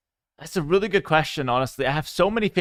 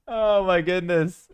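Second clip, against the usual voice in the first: amused disbelief; 5 semitones higher, 4 dB louder, slower than usual. Real, not acted. Reacting to chat's food pick.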